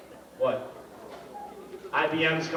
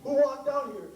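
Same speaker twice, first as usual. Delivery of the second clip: heated repeat; 7 semitones higher than usual. Real, not acted. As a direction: press the accusation harder.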